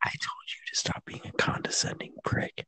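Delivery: very soft mock-secret whisper; hushed bickering; whispered scolding. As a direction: whisper back as if off the record. Whispered staged private quarrel.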